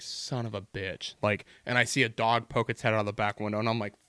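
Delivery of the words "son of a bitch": retold sinking disappointment; muted dismay. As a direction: swear softly to yourself, drawn out. Retold dismay at truck blocking field.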